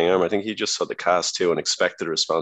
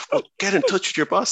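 mock girlfriend; 13 semitones higher than usual. Girlfriend quoted, pitch high.

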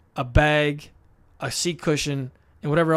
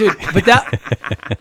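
laughing protest; 3 semitones higher, 8 dB louder, much slower than usual. Real, not acted. Friend's comeback stings.

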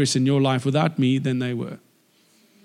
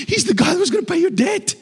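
aghast. Retold or imitated. Horrified bystander in retold scene.

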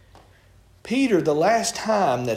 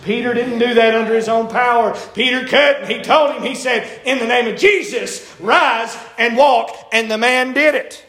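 raised, emphatic sermon delivery; forceful preaching; 3 semitones higher, 3 dB louder than usual. Real, not acted. Preacher proclaiming Peter's miracle to the church, forceful. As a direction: preach it out with rising conviction.

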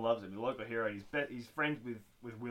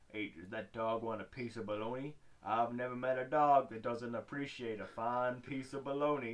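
butcher impression. Imitating the film's butcher.

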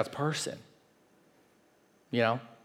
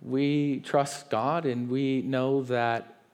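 calm trust. Retold or imitated. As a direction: answer like someone at peace in a storm. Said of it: "And he's like".

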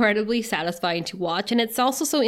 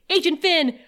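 raised higher command voice; mock commanding call; stern call; 7 semitones higher than usual. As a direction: call his title sharply. Walsh ordering him to stop.

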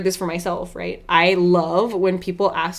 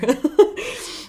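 real laugh; 7 semitones higher, 4 dB louder than usual. Real, not acted. Laughs at her own joke.

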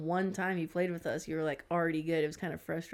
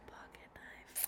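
whispering; 20 dB quieter, slower than usual. Real, not acted. Echoing item in a whisper.

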